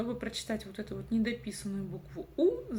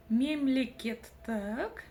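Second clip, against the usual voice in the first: "Мемлекеттік" slow and evenly divided; slow sounding-out of "Мемлекеттік"; syllable by syllable; 3 semitones higher, much slower than usual. Shown as a demonstration. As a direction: stretch each syllable of "Мемлекеттік". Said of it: "нужно разделить по слогам".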